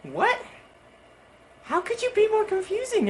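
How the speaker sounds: very high squeak of disbelief; high-pitched comic confusion; baffled. Acted. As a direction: squeak it as a puzzled question. Baffled reaction to confusing boss.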